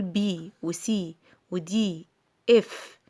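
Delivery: full names, bee, see, dee, eff; letter names. Shown as a demonstration. Teacher contrasting names with letter sounds, saying letter names. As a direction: say بي وسي ودي اف as English letter names, long vowels. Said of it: "لو هقول اسمه".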